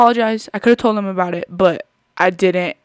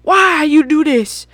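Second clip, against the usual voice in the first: higher, louder mock outrage; mock angry guy; 7 semitones higher, 8 dB louder, slower than usual. Vlogger imagining the guy yelling at her for the spill. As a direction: fake a stranger's angry outburst. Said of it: "What if he got mad".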